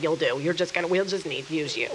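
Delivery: low, soft, fond reassurance; gentle Jesus. Retold or imitated. Jesus to his unready disciples, low and tender. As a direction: soften and drop low, like sending off beloved students.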